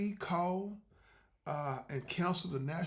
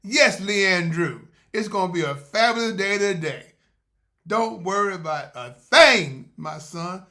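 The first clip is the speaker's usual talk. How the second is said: loud, slow booming divine voice; voice of God; 14 dB louder, slower than usual. Retold or imitated. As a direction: speak as a loud reassuring God. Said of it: "He said".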